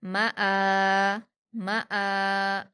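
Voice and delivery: मआ with quick म and long आ; stretched hamza. Shown as a demonstration. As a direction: clip म short, hold आ in मआ. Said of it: "दो हरकत खींचकर अलिफ मद्दा के जैसे पढ़ेंगे".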